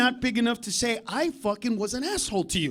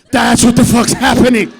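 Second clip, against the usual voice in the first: shouted punchline; 11 dB louder than usual. Acted. Punchline yelled.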